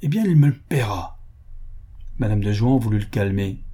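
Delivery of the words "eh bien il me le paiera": higher-pitched, mildly angry vow; a touch of grumbling menace; grumbled threat. A narrator's reading. Offended financier vows payback, grumbling. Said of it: "grommela-t-il".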